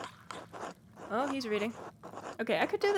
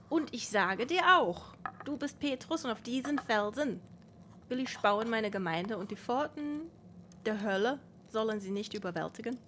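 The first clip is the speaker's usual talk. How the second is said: bouncy, melodic Cologne German; exaggerated Cologne accent; 2 semitones higher than usual. Shown as a demonstration. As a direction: read it with a sing-song Cologne sentence melody, exaggerated. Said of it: "if Mathieu were somehow from Cologne".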